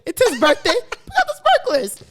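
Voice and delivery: high, excited party voice, laughing; giddy, way up high, laughing through it; mock hyped reply. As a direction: hype it up like it's a party. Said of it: "I said".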